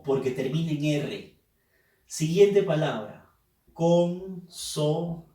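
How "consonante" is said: "Consonante" in separate pieces, "Con, so, nan, te"; syllable by syllable. Shown as a demonstration. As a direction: sound out "Consonante" very slowly, pausing after each syllable. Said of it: "debemos silabear".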